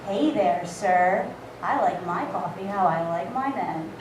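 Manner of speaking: low, breathy-free seductive purr; hammed-up low flirt; sultry flirt. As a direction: pitch way down to seduce. Her flirting with barista.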